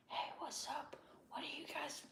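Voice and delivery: mock whisper, breathy and hushed; imitating a whisper. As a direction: whisper it like sneaking a chat on the bus. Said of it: "like, at this voice".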